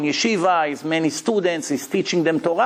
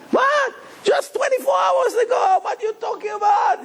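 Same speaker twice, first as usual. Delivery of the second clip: outraged shout. Acted. Cheated rabbi yelling at his rich friend, outraged shout. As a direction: yell it like he's just been robbed.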